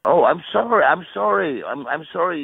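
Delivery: ordinary talk, nothing marked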